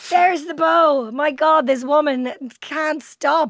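hammed-up amazed outburst; loud mock astonishment. Host acting out astonishment at guest's shops everywhere, loud mock amazement.

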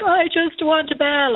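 put-on high, slow whiny little-boy voice; imitating her little boy. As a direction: mimic a sad small child pleading.

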